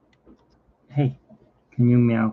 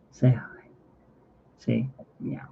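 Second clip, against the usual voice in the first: coaxing whisper; 6 dB quieter than usual. Real, not acted.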